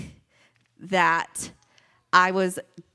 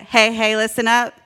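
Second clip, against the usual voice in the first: louder, lifted group chant lead; leading a call-out; 2 semitones higher, 7 dB louder than usual. Shown as a demonstration. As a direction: call it out loud for the room to join. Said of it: "Can you guys say it?".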